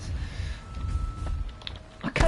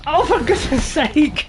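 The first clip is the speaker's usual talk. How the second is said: raised-pitch cry of frustration; exasperated outburst; 5 semitones higher, 4 dB louder than usual. Real, not acted. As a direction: let your exasperation burst out. Player failing repeatedly.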